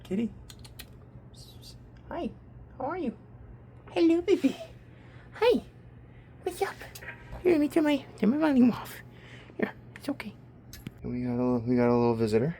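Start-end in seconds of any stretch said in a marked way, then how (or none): 0.0-8.9 high, sing-song pet talk. Real, not acted. Cooing at his cat. 9.6-10.4 whispering. Real, not acted. Soothing the cat.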